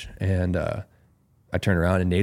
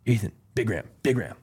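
imitated call-out; 3 semitones higher than usual. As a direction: imitate a buddy getting your attention. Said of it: "And he's like that".

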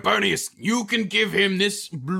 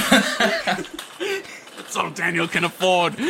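ordinary delivery

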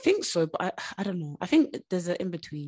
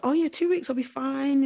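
mock breezy dismissal; 8 semitones higher, 4 dB louder than usual. Quoting her own breezy dismissal.

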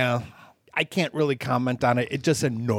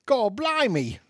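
high, hammed-up British exclamation; mock British accent; 10 semitones higher than usual. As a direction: put on a silly British accent to annoy. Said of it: "I really wish you'd stop".

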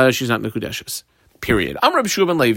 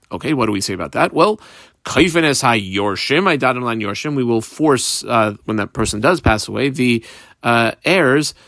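ordinary talk

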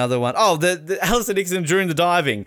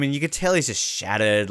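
normal talking voice

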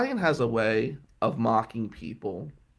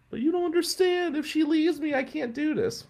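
high, pleading crybaby voice, much higher; mock whiny crybaby; 14 semitones higher than usual. Mimicking the heartbroken classmate, a high pleading whine. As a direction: whine it like a heartbroken student begging.

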